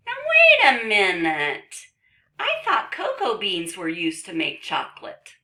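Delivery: loud, high comic interruption; objecting book character. Acted. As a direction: break in loudly like a puzzled cartoon character.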